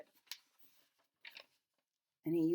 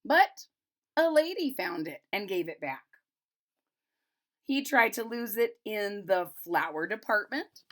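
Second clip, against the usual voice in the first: brighter storybook read, a bit higher; reading to children; 3 semitones higher, 5 dB louder than usual. A narrator's reading. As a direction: read it aloud like a picture book for kids. Reads picture book aloud.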